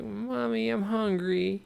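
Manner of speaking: put-on high small child's voice; little child. Boy telling his mother he's hungry, high child voice. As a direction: whine it like a hungry kid. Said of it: "the child's like".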